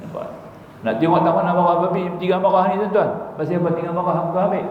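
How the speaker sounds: usual speaking voice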